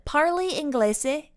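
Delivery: tentative tourist Italian; careful Italian. A narrator's reading. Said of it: "doing her best not to butcher the Italian".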